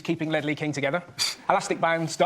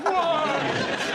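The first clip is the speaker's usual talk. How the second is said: confused; 8 semitones higher than usual. Retold or imitated. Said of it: "people there going".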